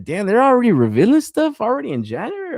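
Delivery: high, impressed surprise; amazed self-quote. Retold or imitated. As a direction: react to unexpectedly early news, pitch leaping up. Re-enacting surprise at early reveals.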